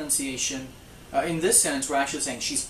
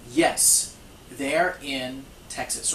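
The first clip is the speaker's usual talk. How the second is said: ordinary delivery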